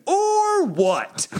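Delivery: high, drawn-out mock challenge; childish defiance. Told by his partner to stop, childishly defiant. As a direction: stretch OR way up high, then drop.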